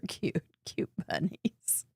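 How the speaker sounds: soft, slow whispered coo. Real, not acted. Host admiring bunny card, soft whisper. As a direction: coo gently at the bunnies.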